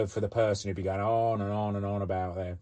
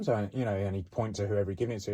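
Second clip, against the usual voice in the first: ordinary talk, nothing marked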